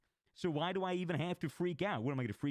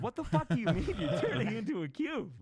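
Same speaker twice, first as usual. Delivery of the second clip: baffled retort; 8 semitones higher, 5 dB louder than usual. Retold or imitated. Teller's own baffled retort.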